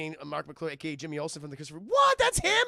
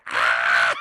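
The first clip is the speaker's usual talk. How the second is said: loud long mock scream; screech; 15 dB louder than usual. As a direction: shriek while being carried off. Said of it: "She's just screeching the whole time".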